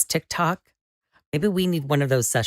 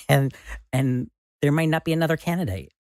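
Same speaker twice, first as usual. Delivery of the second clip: usual speaking voice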